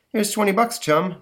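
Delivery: lightly voiced cheerful dupe; Johnny Thunder. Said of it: "says, uh, Johnny".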